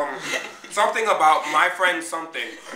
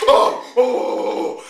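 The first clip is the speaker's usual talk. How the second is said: mock sex moans; 5 semitones higher, 8 dB louder, much slower than usual. Demonstrating noise while giving head.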